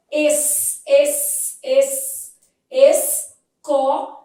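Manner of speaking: loud, repeated "es" with a held S, then "esco"; pronounced S. Shown as a demonstration. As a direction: hammer out "es", pausing after each, then add "co". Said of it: "o S é pronunciado".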